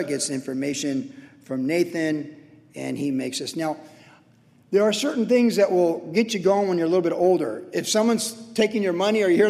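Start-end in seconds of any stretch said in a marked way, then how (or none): none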